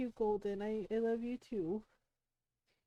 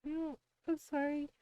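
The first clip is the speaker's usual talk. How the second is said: crying; 5 semitones higher than usual. Real, not acted. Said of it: "Why are you crying?".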